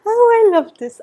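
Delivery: high, loud burst of delight. Real, not acted. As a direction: gush with joy at the card. Delight at the card.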